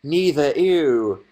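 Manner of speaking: slow drawn-out "Neither, ew" with disgust. Acted. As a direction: stretch out the "ew" like it smells bad. Disgust at chat answer.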